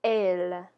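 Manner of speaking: "Elle" with the vowel of yes; French pronunciation. Shown as a demonstration. Teacher confirming after learners repeat the example, French pronunciation. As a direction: confirm "Elle" as a clear, isolated "ell". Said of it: "first example here is the word".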